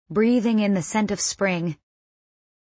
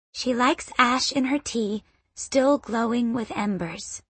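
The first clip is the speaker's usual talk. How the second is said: put-on higher, softer, childlike; little girl voice; 3 semitones higher, 4 dB quieter than usual. A narrator's reading. Girl telling the boy a secret, childlike. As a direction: tell it like a proud small child.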